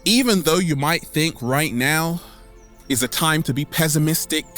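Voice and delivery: normal talking voice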